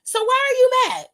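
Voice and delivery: high, loud incredulous questioning; asking in stunned disbelief at the wrestler; shocked disbelief. Retold or imitated. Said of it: "I was shocked because I'm like".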